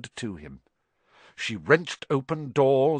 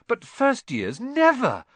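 high astonished squeak; astonished question; 13 semitones higher, 6 dB louder, slower than usual. Acted. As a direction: gasp out the word. Ron astonished.

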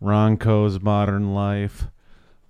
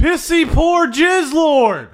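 high, loud infomercial voice; pitchman voice; 5 dB louder, slower than usual. Acted. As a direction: announce it like a TV ad. Announcing title loudly.

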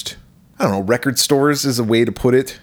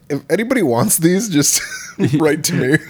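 mock announcement voice; 6 semitones higher than usual. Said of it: "just be like".

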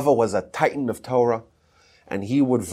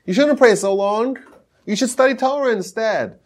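high-pitched, raised-voice mock scolding; acted scolding scream; 10 semitones higher, 4 dB louder than usual. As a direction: imitate a rabbi yelling at someone praying too long. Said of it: "he starts screaming at him".

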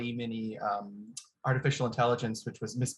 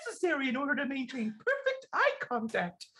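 very high diva puppet falsetto; Miss Piggy. Shown as a demonstration. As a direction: squeal it out like a pig diva, reading in character. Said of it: "I'm gonna try this quick Miss Piggy".

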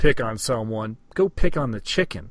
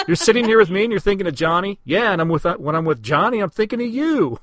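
quick, raised jealous pleading; jealous lover; 7 semitones higher, 5 dB louder than usual. Acted. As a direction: demand an answer, hurt and pushy. Acted lovers' quarrel dialogue.

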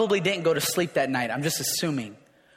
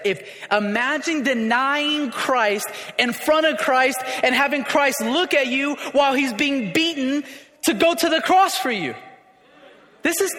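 preaching climax; 6 semitones higher than usual. Acted. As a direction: build it up toward the cross.

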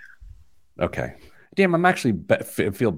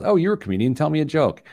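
lightly mimicked eager stranger, higher pitched; pestering stranger; 6 semitones higher, faster than usual. Stranger badgering a comedian for a joke, lightly mimicked. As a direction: toss it out as a higher-pitched mock request. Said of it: "when someone says".

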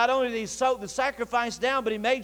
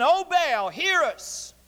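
higher-pitched mock call to a god; imitated crying out; 6 semitones higher, much slower than usual. Prophets calling on Baal for hours, imitated crying out. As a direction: call upward in a retold plea. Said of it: "screaming out".

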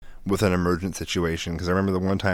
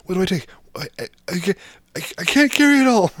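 high, flustered mock panic; pitched way up, helpless and overwhelmed; panicky friend; 12 semitones higher, 4 dB louder, faster than usual. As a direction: fumble like you can't choose what to grab.

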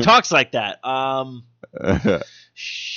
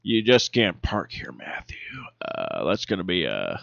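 whispered cop bit; 8 semitones lower, 9 dB quieter than usual. Pretend. Mock cop voice.

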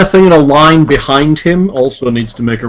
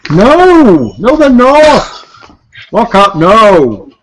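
high, slow, drawn-out no; dramatic protest; 7 semitones higher, much slower than usual. Acted. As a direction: wail it out in mock despair. Protesting a failed save.

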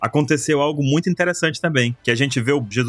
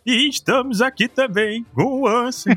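damp muffled mushroom voice; 8 semitones higher, slower than usual. Pretend. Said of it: "com a voz do Cogumelo do Sol".

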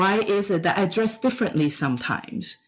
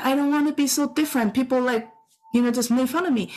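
lightly imitated child; 6 semitones higher, faster than usual. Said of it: "And so he said".

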